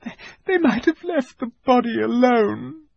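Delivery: very high, broken tearful voice; stammering through tears. A narrator's reading. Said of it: "sobbed Lucy".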